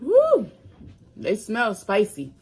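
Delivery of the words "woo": excited whoop. Real, not acted. Reacts to spicy smell.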